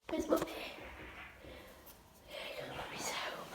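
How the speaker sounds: hushed whisper to pets. Real, not acted. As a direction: whisper softly to the cat.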